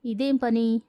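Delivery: ordinary delivery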